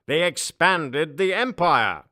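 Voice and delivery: a ruler boasting of conquest; triumphant emperor. Acted. Emperor proclaims.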